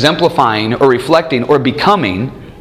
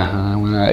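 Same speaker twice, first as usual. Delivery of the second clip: put-on soft breathy baby grunts, much softer; baby's imitating grunt; 6 semitones lower, 6 dB quieter than usual. Dad imitating his baby son copying his noises. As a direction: copy a baby trying to mimic you. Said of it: "And he started going".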